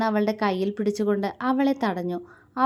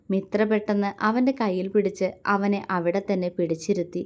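ordinary delivery, nothing marked

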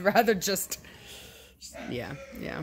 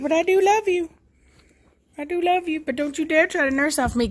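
high put-on doggy voice, loving then stern; voicing the mother dog; 10 semitones higher, 3 dB louder, faster than usual. Owner voicing the mama dog scolding her puppies in a high voice. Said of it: "She's like".

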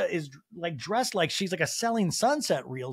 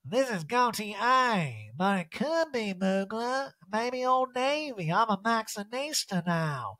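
high, very slow diva impression; hammed-up lofty put-on voice; Erica; 6 semitones higher, slower than usual. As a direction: draw out each phrase languidly. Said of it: "And Erica's like".

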